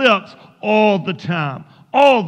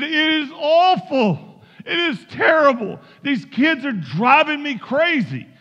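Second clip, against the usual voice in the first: frazzled complaint; 4 semitones higher than usual. Acted. Frazzled mother unloading on a caller about her kids, exasperated. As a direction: put on an overwhelmed mother at wits' end.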